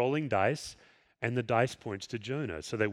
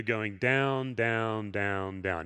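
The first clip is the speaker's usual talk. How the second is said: each "down" lower and softer than the last, sinking away; fading repeated down; 4 semitones lower, 3 dB quieter, much slower than usual. Shown as a demonstration. Preacher tracing Jonah's descent, each "down" fading. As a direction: let "down" sink lower and quieter each time.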